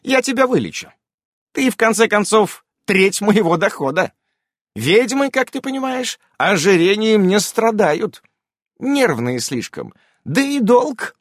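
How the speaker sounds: high, brisk witch voice; a busy witch promising a cure; high lively witch voice; witch Irene voice. A narrator's reading.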